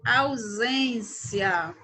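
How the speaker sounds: "ausência" slow, with the S said as a buzzing Z; S sounded as Z. Shown as a demonstration. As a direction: stretch out "ausência" and voice the S like a Z. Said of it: "o S com som de Z". ("ausência" bem devagar, com o S soando como Z)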